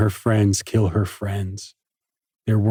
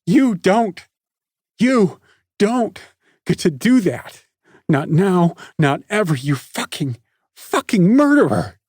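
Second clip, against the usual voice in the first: much higher and louder, spitting rage; furious screaming; 9 semitones higher, 4 dB louder than usual. A narrator's reading. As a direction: scream the accusation in his face. Nikki screaming at Parker.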